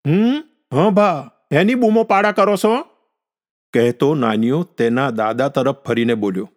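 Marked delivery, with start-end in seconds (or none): 0.1-2.8 put-on high-pitched boy's reply; grandson answering back. A narrator's reading. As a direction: answer grandpa's shouting in a raised child's voice. Grandson answers grandfather's repeated shouts. (દાદાને ઊંચા સૂરે જવાબ આપતો છોકરો)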